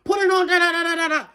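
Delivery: high, loud imitation of casual yelling; excited, high-pitched mimicked shout; rowdy everyday banter. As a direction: holler it like guys round the TV. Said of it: "that's how they talk".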